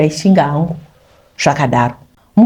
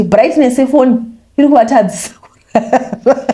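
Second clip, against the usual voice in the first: mocking through laughter; 6 semitones higher than usual. Mocking brother's complaint, laughing.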